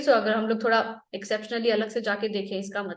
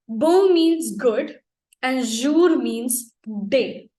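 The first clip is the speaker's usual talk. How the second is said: slow explaining; 3 dB louder, much slower than usual. Shown as a demonstration. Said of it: "teacher spells out meanings slowly".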